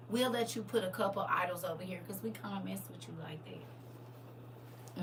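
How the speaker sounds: ordinary talk, nothing marked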